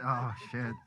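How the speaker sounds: usual speaking voice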